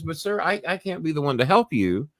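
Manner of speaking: lightly voiced operator saying she can't help; imitating sweet operator. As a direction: let her down gently, up high. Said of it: "And she says".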